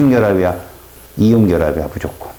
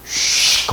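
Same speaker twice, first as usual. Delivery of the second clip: whispered whoosh; 4 dB quieter, much slower than usual. Shown as a demonstration. Imitating spinning metal.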